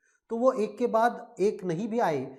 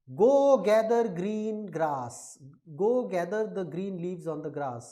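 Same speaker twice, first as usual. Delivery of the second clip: "Go gather green grass" very slow and louder, each g stressed; alliteration demo; 4 dB louder, much slower than usual. Shown as a demonstration. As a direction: drag out every opening g. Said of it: "ग, ग". (हर "ग" पर ज़ोर, बहुत धीरे)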